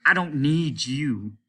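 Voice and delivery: loud, slow rejection; angry slow dismissal; harsh. A narrator's reading. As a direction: spit it down at him. Captain snaps.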